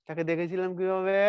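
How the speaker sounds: normal talking voice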